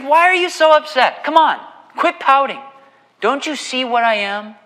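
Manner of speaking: high, loud mocking of a smug husband. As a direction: sneer it like a self-satisfied husband. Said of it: "And so he comes and says".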